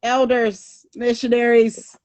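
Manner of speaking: mock fed-up hello across the street; exasperated greeting. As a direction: call out to them with a weary ugh. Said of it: "I was like".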